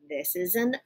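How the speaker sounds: normal talking voice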